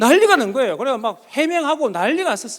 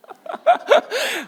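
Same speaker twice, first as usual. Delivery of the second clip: high-pitched burst of laughter; high giggling laugh; embarrassed laughter. Real, not acted. Laughs at own confession.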